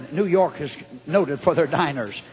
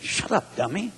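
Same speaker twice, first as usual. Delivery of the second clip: muttered self-scolding; 6 dB quieter than usual. Retold or imitated. Preacher retelling how he told himself off, muttered. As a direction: scold yourself under your breath.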